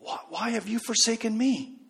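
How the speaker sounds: soft, hurt plea from a daughter to her parent; a quiet, lightly voiced child begging "why are you doing this to me"; pleading child. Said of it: "looks pleadingly at you and says".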